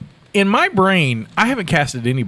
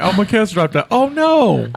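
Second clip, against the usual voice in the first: higher, hammed-up cry of fake despair; oh no, my poor cast, pitched high and overdone; hammed-up fake distress; 6 semitones higher than usual. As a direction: play the helpless director to trick friends. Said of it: "So I just trick them into it".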